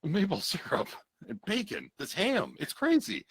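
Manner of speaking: goofy Canadian voice. Acted. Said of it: "He's like".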